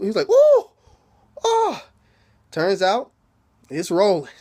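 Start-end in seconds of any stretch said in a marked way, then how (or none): 0.3-1.9 very high mock cries of pain; mock yelps. Storyteller imitating the whipped man, high mock yelps. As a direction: squeal in comic pain, very high.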